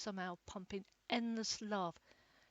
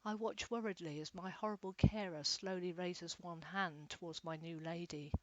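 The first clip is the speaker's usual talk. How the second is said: ordinary delivery, nothing marked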